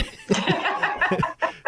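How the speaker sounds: loud high laugh. Real, not acted. Laughing hard at Mark's teasing, loud high laugh.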